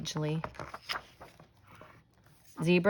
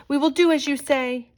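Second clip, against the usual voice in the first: higher, louder eager promise; lightly voiced creatures; 8 semitones higher, 5 dB louder than usual. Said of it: "called the creatures".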